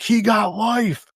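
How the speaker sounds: high, drawn-out mock cry; stretched high imitation of a yell. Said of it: "instead of yelling it".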